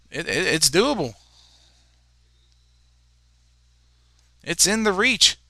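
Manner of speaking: excited commentary. Real, not acted. Announcer telling listeners don't go anywhere, fired up. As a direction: keep the energy up after the goal. Hyped after Logan's goal.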